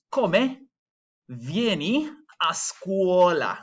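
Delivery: "Come" high with a clear final E, "vieni a scuola" very slow; final E pronounced. Shown as a demonstration. Teacher repeating slowly for the class, clear vowels. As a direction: voice the E of "Come" and stretch the rest. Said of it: "that E at the end we're pronouncing".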